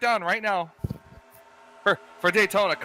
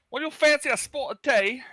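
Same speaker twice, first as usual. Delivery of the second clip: higher, hammed-up British; mock British accent; 8 semitones higher than usual. Commentator kidding co-hosts about the accent, mock British. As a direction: put on a jokey British accent. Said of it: "a Southern accent and a British accent".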